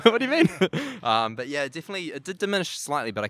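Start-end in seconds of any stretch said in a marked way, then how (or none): 0.0-0.5 laughing high and loud. Real, not acted. Laughing at being asked origin. 0.5-1.0 bright loud laugh; high laugh. Real, not acted. Laughs after retold question.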